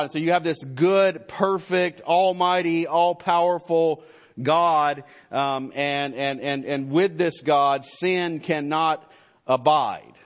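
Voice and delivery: rising, loud pulpit fervor, pressing each word; impassioned sermon climax, hammering home God's holiness; full-force preaching. Acted. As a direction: thunder it out like a revival preacher.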